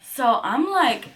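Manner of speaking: ordinary delivery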